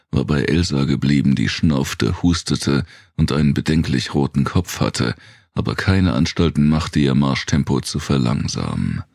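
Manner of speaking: ordinary delivery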